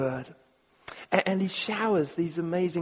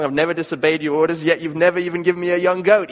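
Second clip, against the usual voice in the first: fast, louder bitter protest; resentful complaint; 2 semitones higher, 13 dB louder, faster than usual. Acted. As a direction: fling the grievance at him. Resentful son complains to father.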